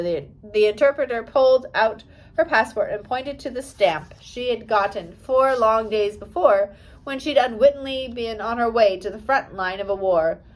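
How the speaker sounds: usual speaking voice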